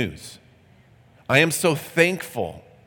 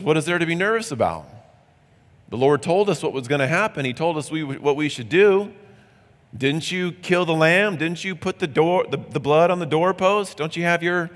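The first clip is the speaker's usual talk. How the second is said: higher and fast, eager rebuke; higher, fast, insistent friend pressing his point; confident insistence; 4 semitones higher than usual. Acted. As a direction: rattle it off, sure and incredulous. Confident man scolds anxious friend.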